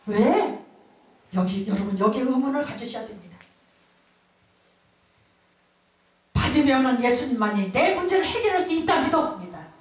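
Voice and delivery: building fervent preacher delivery; fervent preaching. Acted. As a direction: preach it to the congregation with conviction. Preaching at full force.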